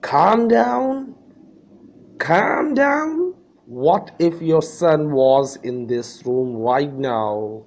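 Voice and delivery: incredulous high, loud outburst; angry father. Acted. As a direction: throw it back in outrage, voice leaping up. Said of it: "said the father angrily". (गुस्से में चिल्लाता पिता)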